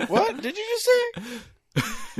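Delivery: very high, incredulous squeak; put-on high voice, halting; mock disbelief. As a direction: squeak it out with a pause before the last word. Mock reaction to the odd accent.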